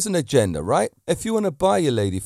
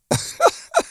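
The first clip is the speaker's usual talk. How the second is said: sudden high, short laugh; burst of laughter; 4 dB louder than usual. Real, not acted. Laughing at the coworker's new-bird remark, sudden high laugh. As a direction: laugh out at the memory. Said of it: "and I chuckled".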